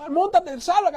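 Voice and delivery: high, hurried call to climb aboard; acted rescuer calling out; urgent rescue call. Speedboat crew calls to the drowning man, urgently.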